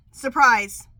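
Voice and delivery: one loud, punched word; loud exclamation. Real, not acted. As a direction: call out the word. Announcing surprising prediction.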